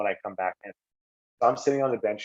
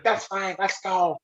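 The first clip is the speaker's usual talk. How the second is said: high-pitched false bravado; put-on toughness, voice pitched up; acting tough; 9 semitones higher, much slower than usual. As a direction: imitate yourself pretending to be unafraid. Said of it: "trying to act tough".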